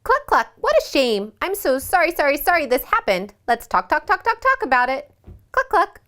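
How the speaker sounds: high clucking hen, rising as she goes; chicken voice. Acted. Mom voices the sorry chicken for the kids, high clucking. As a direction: sing it out like a fussy, sorry hen. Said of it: "Did I do a good chicken voice?".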